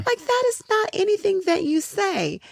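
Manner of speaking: astonished at an audience member's rudeness; retold disbelief. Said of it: "I was like".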